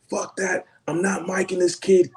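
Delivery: high-pitched, indignant New Yorker; mock upset refusal. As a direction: imitate an upset crew guy refusing. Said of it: "visibly upset".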